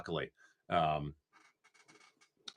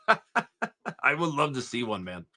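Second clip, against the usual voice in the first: laughing through the line, slowed; amused chuckle while talking; 3 semitones higher, much slower than usual. Real, not acted. Laughing at a chat message.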